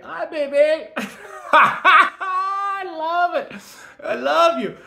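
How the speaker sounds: very high, loud lovestruck squeal; giddy squeal. Real, not acted. Greeting a soulmate, squealing with delight. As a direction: squeal with laughter, stretching the words out.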